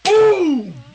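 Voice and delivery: loud, high whoop; excited cheer. Real, not acted. Friends whoop at the kiss in the story, loud and high. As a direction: shout it out like a goal was scored.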